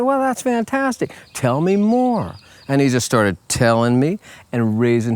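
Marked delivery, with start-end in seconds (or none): 0.0-2.3 much higher, beaming and eager; enthusiastic encouragement. Retold or imitated. Teacher to student sharing good news, eagerly encouraging. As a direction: cheer him on, big and bright.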